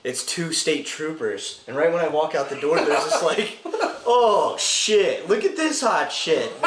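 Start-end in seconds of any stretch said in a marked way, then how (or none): 4.1-6.5 high, loud, drawn-out reaction; retold wowed outburst; mock astonishment. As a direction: stretch it out in exaggerated amazement.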